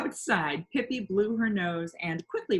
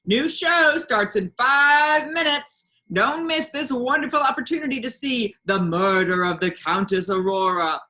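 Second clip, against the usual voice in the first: barker shouting; 6 semitones higher, 9 dB louder than usual. A narrator's reading. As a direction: bellow it to the whole fairground. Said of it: "a man was shouting".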